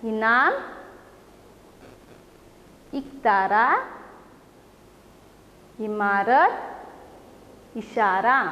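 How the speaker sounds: put-on slow, clear words for children to repeat; clear teacher reading. Shown as a demonstration. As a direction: read each word slowly for the class to echo. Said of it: "आप सभी को मेरे पीछे पीछे पढ़ना है". (बच्चों के दोहराने के लिए धीरे, साफ़ पढ़ना)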